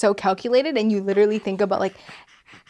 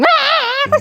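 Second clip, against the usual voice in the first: high-pitched mock shriek; imitated baby scream. Imagining the child interrupting the proposal, mock scream. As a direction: let out a sudden high squeal. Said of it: "She starts screaming".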